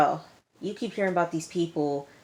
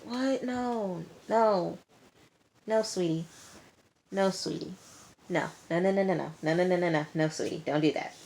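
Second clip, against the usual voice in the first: mock scolding; 2 semitones higher, 4 dB quieter than usual. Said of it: "And I'm like".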